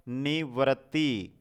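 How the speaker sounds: निवृत्ति stretched, each sound clear; slow spelling. Shown as a demonstration. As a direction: drag out निवृत्ति. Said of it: "double त आएगा".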